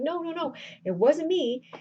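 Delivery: high-pitched mock protest; hasty denial. Cyrano denies the secret to Roxanne, protesting. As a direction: deny it in a lifted, insistent voice. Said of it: "he denies, he keeps trying to deny it".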